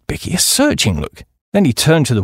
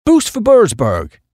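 higher-pitched, drawn-out booster's motto; town slogan; 6 semitones higher, 4 dB louder, slower than usual. Acted. Chappie boosting his town to a visiting Duke, proud slogan. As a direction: voice the slogan high and slowly.

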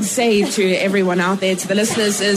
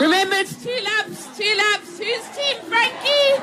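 high excited calls to the crowd; rally the crowd at the pool; poolside cheering; 12 semitones higher, 3 dB quieter, slower than usual. Real, not acted.

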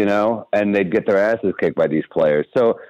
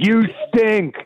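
heckler yell; 14 semitones higher than usual. Retold or imitated. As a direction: open the heckle with a sharp jeer. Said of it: "They're just in the stands like".

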